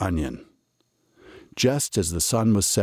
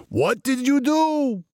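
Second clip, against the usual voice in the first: Reb Stein shouting; slower than usual. A narrator's reading. Reb Stein demanding answers from Muddle, shouting. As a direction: shout the question at him.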